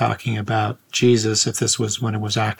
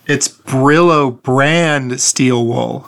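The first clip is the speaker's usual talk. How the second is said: slow; 2 semitones higher, 5 dB louder, much slower than usual. Acted. Explaining the product name.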